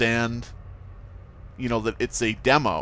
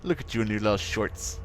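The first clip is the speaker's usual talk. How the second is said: ordinary delivery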